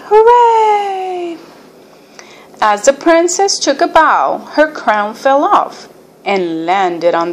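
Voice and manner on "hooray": high, drawn-out cheer. A narrator's reading. Audience applauding the end of the play, cheering. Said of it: "The audience clapped and cheered".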